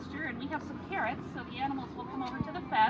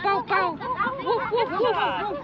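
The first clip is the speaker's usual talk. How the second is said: barking dog imitation; 7 semitones higher, 7 dB louder, much slower than usual. Child imitates farm animals.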